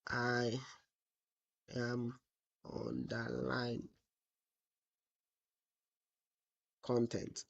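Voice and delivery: very slow, low, word by word; typing aloud. Real, not acted. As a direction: murmur each word as you type it, with long gaps. Dictating to self while typing.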